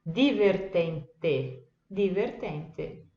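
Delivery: divertente slow, hard d, final eh; Italian divertente. Shown as a demonstration. Teacher slowly models divertente. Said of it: "que é di".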